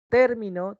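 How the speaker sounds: "Término" as TÉR-mi-no; stress on first syllable. Shown as a demonstration. Teacher quizzing students on meaning, "Término" stressed on TÉR. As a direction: hit the opening syllable of "Término". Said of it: "su desplazamiento distingue el significado".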